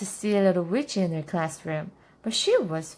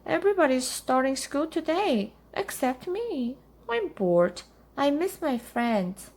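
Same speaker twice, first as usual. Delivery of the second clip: complaining little witch; 7 semitones higher than usual. A narrator's reading. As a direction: complain to mother in a little girl's higher voice. Bored child complains to mother.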